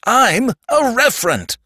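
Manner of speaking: high-pitched panicked outcry; acted scream. A narrator's reading. Sandman insisting on what he is, screaming. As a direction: scream it as a desperate claim. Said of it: "screamed the Sandman".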